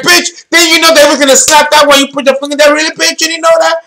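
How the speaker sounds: high, rapid-fire teasing scolding; mock disbelief. Astrologer ribbing the film heroine, mock disbelief. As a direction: rattle it off high and incredulous.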